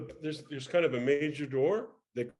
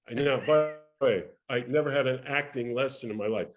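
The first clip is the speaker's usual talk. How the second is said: ordinary talk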